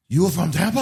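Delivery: a hint of French surprise, pushing up on Tampa; French accent. French businessman surprised he's from Tampa, mock French accent. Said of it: "in a very thick, uh, French accent".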